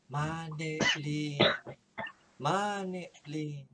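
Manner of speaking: slow, drawn-out mysterious calling of a name; a hidden voice calls out her name, drawn out; eerie whisper call. Acted. Unseen whisperer calls Nene by name, slow and eerie. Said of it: "may bumulong sa kanya".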